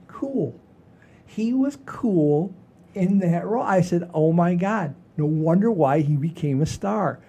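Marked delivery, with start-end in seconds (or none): none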